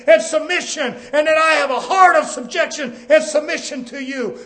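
fervent preacher building a list; full-force preaching. Real, not acted. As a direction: hammer out each phrase at a high pitch.

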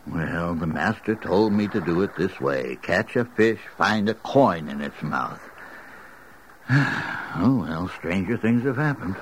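ordinary talk

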